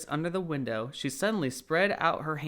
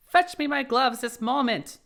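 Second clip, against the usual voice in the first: impatient order; 10 semitones higher, 5 dB louder than usual. Acted. Rabbit orders his maid.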